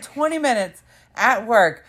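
mock exasperation. Retelling friend's exasperated complaint.

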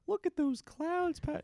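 high, drawn-out cloud-spotting call; imitating excited friend. Host retelling friend's trip to Rome, high mock excitement. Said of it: "she'd just point up to".

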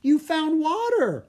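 put-on thrilled girl's exclamation; happy. A narrator's reading. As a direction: sing out the joy of finding water. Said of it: "Anna said happily".

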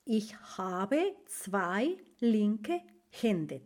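teacher repeating the German idiom slowly; slow model phrase. Shown as a demonstration. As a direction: say it slowly and gently for learners. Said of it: "entonces se dice".

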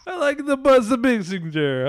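high-pitched mock Russian accent; badly faked Russian accent; botched Putin accent. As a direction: repeat it in a silly, bad Russian accent. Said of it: "my accent was really fucked up".

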